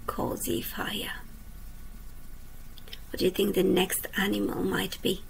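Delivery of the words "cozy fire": slow whispered ending, drawn out; hushed refrain. A narrator's reading. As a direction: breathe the last words like a lullaby. Refrain end of each verse.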